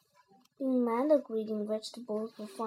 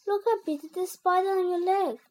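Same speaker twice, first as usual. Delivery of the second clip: high-pitched urgent warning; mock alarm, pitched up; 6 semitones higher, 4 dB louder, faster than usual. A narrator's reading. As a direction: scare your little brother. Henry fakes alarm as distraction.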